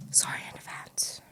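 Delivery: soft breathy whisper; whispered apology. Real, not acted. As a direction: whisper it like a secret. Apologising for chewing sounds.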